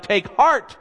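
high, loud call of reassurance. Acted. Jesus calling to his frightened disciples, a high loud cry. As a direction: cry out over the storm to lift them. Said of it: "But Jesus cries out".

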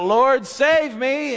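high, loud, slow cry for help; desperate cry. Acted. Peter sinking in the waves, crying to Jesus in a high loud plea. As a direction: plead for rescue as you sink. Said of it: "and he cries out".

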